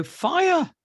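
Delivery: put-on high-pitched little-boy alarm; imitated frightened child. Imitating his son naming his fear to Dad, frightened. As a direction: blurt it like a scared child. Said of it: "He'd go".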